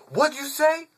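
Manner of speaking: high, loud challenge shouted at the guys; angry yell. Retold or imitated. K yelling at the bench guys who insulted L. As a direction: bark it at the group. K confronting the group.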